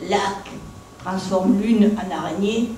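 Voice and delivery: usual speaking voice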